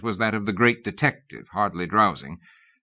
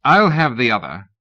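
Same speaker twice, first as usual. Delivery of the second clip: aristocratic voice; 4 semitones higher, 7 dB louder than usual. A narrator's reading. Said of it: "came an aristocratic voice".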